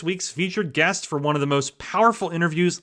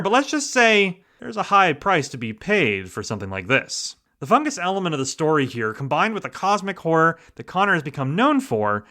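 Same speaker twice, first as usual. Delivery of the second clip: usual speaking voice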